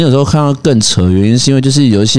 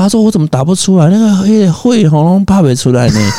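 put-on Taiwanese accent; 7 semitones higher than usual. Mother voiced in story.

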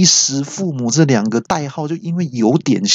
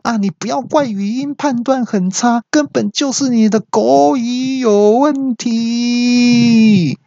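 yelling back; 13 semitones higher, slower than usual. Retold or imitated. Coworker yells back blaming the speaker's pronunciation, high and slow. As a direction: shout back indignantly. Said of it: "小陈也大叫说".

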